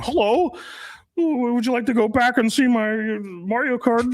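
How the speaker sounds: awkward nerdy guy. Retold or imitated. Mocking a logical guy inviting a girl, awkward nerd voice.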